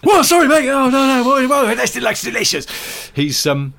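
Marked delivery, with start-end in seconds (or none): 0.0-2.7 very high, loud excitable yelling; over-the-top excited TV presenter; hyperactive presenter. Acted. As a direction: gush in a frantic high-pitched shout. Acting the hyperactive shouting presenter.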